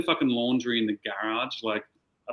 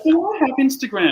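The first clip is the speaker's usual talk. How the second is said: mimicking a baffled mum, higher and louder; confused mum; 12 semitones higher, 7 dB louder than usual. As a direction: play the clueless mum, pitched up and loud. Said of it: "And she's like".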